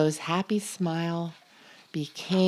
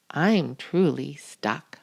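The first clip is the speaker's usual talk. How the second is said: ordinary delivery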